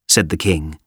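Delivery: usual speaking voice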